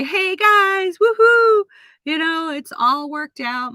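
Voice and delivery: high, loud cheer easing into good news; celebratory announcement. Acted. Vlogger imagines announcing a new job to viewers, acted celebration. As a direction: whoop a triumphant greeting to everyone. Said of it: "I wanna just come on here and say".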